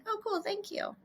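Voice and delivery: high, soft imitation of a husband; easy acceptance. Husband accepting wife's apology on a hike, easy high soft acceptance.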